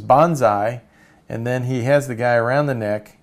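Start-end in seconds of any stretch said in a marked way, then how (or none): 0.0-0.8 loud mock battle cry; retold scream. Cartoon zealot charging at a stranger, mock scream. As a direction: shout it like a cartoon charge, half in earnest. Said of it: "He screams".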